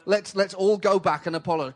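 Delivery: a lightly voiced diplomat calming everyone down; peacemaker Kofi Annan. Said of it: "Kofi Annan jumping in the middle going".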